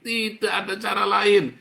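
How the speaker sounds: climbing pulpit shout; loud exhortation. Real, not acted. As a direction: drive it home harder. Preacher exhorting congregation.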